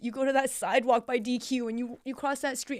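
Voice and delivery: put-on high, loud call across the street to the kids; yelling at students. Teacher yelling to her students not to jaywalk, loud and high. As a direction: shout a warning like a protective mama. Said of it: "I yelled at them".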